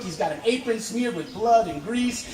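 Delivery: ordinary delivery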